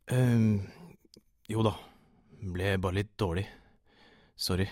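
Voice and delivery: nervous, hesitant excuse; shaky voice. A narrator's reading. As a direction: falter through an apology. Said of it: "Stemmen min skalv". (lett skjelvende, nølende)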